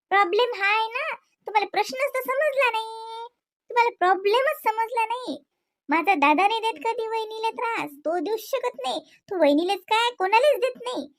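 frustrated wife raising her voice at husband; exasperated insisting. Acted. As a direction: push hard, she's fed up explaining.

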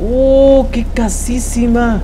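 exclamación aguda y alargada; asombro admirado. Real, not acted. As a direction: estira las palabras, maravillado ante la casa. Admiring a house in the game. (admiración entusiasta, con voz aguda)

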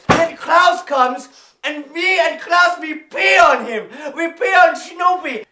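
put-on excited German-accented shouting; hammed-up triumphant rant; manic gloating. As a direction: rave gleefully, carried away by the tale. Pilot gloats on, carried away.

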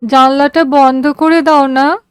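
a feverish daughter asking faintly; tired, weary plea. A narrator's reading. Sick daughter to father in the train, weary. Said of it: "খুব ক্লান্ত, অবসন্ন কণ্ঠে বলল".

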